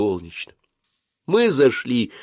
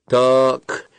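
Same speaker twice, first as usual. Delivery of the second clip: higher, mildly angry exclamation; 5 semitones higher, 4 dB louder than usual. A narrator's reading. Dentist losing patience with the boy, crossly. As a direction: snap it with rising temper. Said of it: "разозлилась она".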